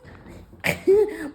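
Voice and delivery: high giggle. Real, not acted. Laughing at own impression.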